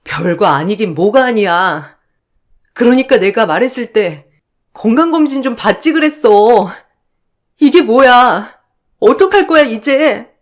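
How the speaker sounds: 점점 높아지며 울분 섞인 추궁, 어떡할 거야에서 확 치솟게; 걱정이 분노로 터지듯 엄마를 다그쳐; 속상한 다그침. Acted. 엄마를 다그치며 울먹임. (검진 안 받은 엄마에게 울분 섞어 다그치는 딸)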